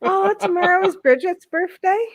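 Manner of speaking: ordinary talk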